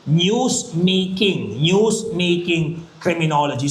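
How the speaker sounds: "News-making" said very slowly, each syllable spaced; slow emphasis. Shown as a demonstration. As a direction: drag out "News-making" for the class. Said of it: "ano tong tinatawag natin". (binibigkas nang napakabagal ang "News-making")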